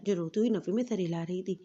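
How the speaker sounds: ordinary delivery, nothing marked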